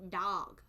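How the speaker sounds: drawn-out "dog" with an ah vowel; northern pronunciation. Shown as a demonstration. Showing how northerners say it, "dog" as dahg. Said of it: "they go dog".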